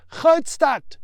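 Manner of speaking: very loud, high shout of the town; boy shouting his destination. Acted. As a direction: yell it over the engine. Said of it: "shouts little Mikey".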